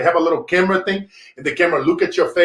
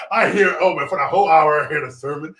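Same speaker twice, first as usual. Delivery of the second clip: usual speaking voice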